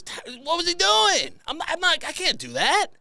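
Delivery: high, stunned I can't do that; incredulous. Retold or imitated. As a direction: squeak out a disbelieving protest. Imitating the gym guy's exercise.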